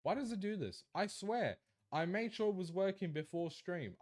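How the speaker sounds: baffled and fed up with the tech; frustrated whine. Real, not acted. Stream keeps breaking.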